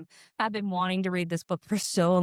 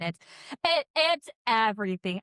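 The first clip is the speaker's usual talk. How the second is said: ordinary talk, nothing marked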